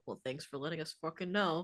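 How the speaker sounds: low, fast imitated mutter; under the breath. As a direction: mumble it quickly as a muffled aside. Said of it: "hearing you under your breath go".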